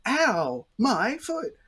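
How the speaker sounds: loud, high theatrical cry of hurt; hammed-up injury wail; overdone pain. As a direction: yell it out for the whole food court to hear. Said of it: "they're not gonna go".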